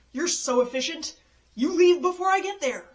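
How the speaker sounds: building mock-furious rant; sarcastic outburst. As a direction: spit the sarcasm at the airport. Venting sarcasm at airport.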